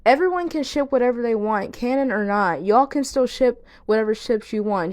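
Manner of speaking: ordinary talk, nothing marked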